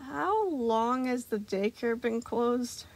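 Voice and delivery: slow, careful question; wary. A narrator's reading. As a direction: ask it slowly, on guard. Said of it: "Gregory asked warily".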